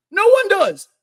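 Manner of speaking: loud, high exasperated retort; heated shout. Real, not acted. Heated rebuttal to critics.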